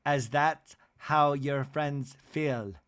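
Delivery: slow, halting put-on voice; measured mock reproach, pausing between phrases; Whitney. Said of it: "And then Whitney goes".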